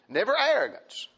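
normal talking voice

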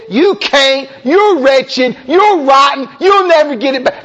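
high, accusing devil's taunt. Acted. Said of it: "he wails on you and says".

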